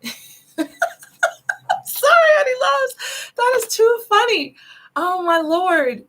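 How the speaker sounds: high, slow giggly apology; giggling. Real, not acted. Giggling apology to viewers after the funny personal reading. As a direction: giggle through the sorry. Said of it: "I'm gonna stop giggling".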